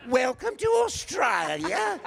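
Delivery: high, loud, indignant Australian shriek; pronounced Australian accent. Retold or imitated. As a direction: drag it out in broad, outraged Australian. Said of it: "she was really talking like that".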